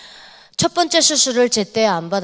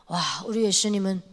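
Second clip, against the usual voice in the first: normal talking voice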